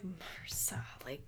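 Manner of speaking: whispered in disbelief; "mrsa" whispered slow and stunned; shocked whisper. Real, not acted. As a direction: breathe the word mrsa in shock, slowly. Stunned repeating of diagnosis.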